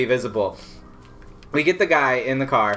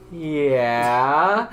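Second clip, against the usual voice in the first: drawn-out yeah sliding up; unsure, rising, stretched-out consent; reluctant yes. Retold or imitated. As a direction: agree while audibly doubting it. Reluctantly agreeing to another favour.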